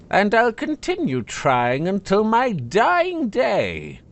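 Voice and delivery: slow, cheerfully spiteful sword. Acted. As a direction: cheerfully promise your malice. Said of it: "Malice agreed cheerfully".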